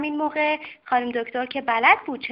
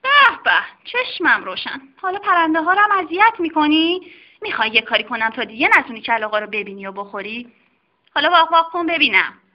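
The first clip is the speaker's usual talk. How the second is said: higher, fast mock-stern telling-off; scolding doctor; 3 semitones higher, 4 dB louder, faster than usual. Doctor scolding the dog for bullying kittens, mock-stern. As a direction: wag a finger at the naughty dog. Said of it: "کلاغ سیاهی رو بیرون کشید".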